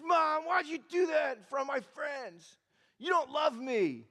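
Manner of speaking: put-on high, whiny protest of an upset kid; mad little boy. Embarrassed boy complains to his mom, high hurt protest. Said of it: "got mad, made that face".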